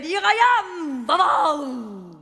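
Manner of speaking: loud triumphant announcement; mock grand entrance cry; calling out. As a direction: announce yourself grandly to the whole town. Grand arrival in Hollywood.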